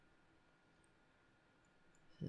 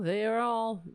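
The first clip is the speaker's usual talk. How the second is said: usual speaking voice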